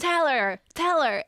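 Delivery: mock cheering. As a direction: urge her on like a rowdy fan. Acting out viewers cheering at home.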